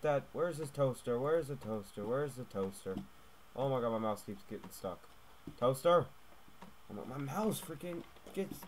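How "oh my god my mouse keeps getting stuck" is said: ordinary talk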